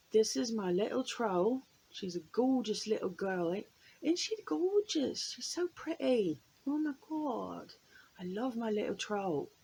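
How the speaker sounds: gushing, lilting attempt at an English accent. Shown as a demonstration. Vlogger showing off her little troll in a practiced English accent. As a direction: put on an English accent, swooping up as you coo. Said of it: "practicing my English accent".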